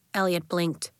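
ordinary delivery, nothing marked

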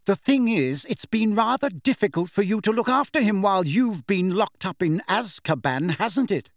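high-pitched, sneering jab; scornful. A narrator's reading. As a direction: make the barb sound falsely reasonable. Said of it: "her lip curling".

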